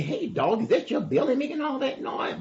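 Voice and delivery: high, incredulous donkey voice; donkey character. Acted. Donkey asks dog about his noisy belly, high puzzled voice.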